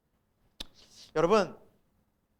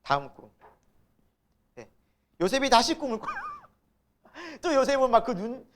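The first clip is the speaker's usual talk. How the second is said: normal talking voice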